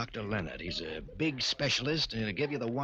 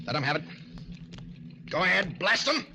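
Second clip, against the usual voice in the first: louder, higher, egging him on; shouted order to blast the cop; urging shout; 7 semitones higher, 3 dB louder, faster than usual. Acted. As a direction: push him hard to shoot. Gangster urges a shooting.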